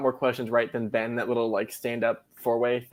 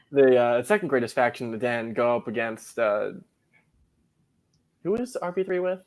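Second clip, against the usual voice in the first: usual speaking voice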